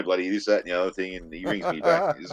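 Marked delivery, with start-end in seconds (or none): none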